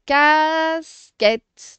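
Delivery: "casquette" with a long, loud first syllable; very drawn-out, loud "casquette"; stretched syllables. Shown as a demonstration. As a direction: hold "cas" out, then land "quette". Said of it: "on fait toujours les syllabes".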